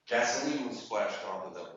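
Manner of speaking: ordinary delivery, nothing marked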